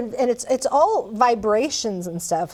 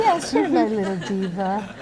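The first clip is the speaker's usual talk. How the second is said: talking to a plant; 4 semitones lower than usual. Pretend. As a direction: coo at the orchid like a pet. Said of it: "I think that I would say".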